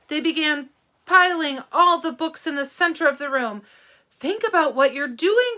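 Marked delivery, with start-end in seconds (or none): 4.2-5.6 loud, higher plea; loud pleading cry; cried out. Acted. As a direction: beg the mob to stop. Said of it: "cried Miss Catherine".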